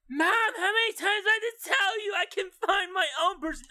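put-on high, whiny teen daughter protesting. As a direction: cry out at mom like a fed-up teen. Daughter scolds interfering mother.

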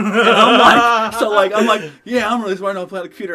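laughing through the words; can barely talk for laughing. Real, not acted.

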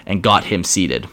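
ordinary delivery

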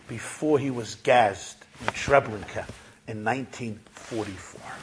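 low, soft, long pauses between phrases; hushed gravity. Retold or imitated. Old man asking about the rabbi's last wedding, hushed. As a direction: let each phrase fall softly and gravely.

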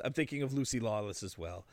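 usual speaking voice

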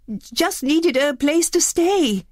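slow, high and timid; timidly. Acted. Maurice answers the beast, slow and timid. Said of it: "said Maurice timidly".

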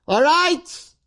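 high-pitched sustained call. Acted. Heavenly judge opens the weighing, high calling out. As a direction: sing it out as an announcement.

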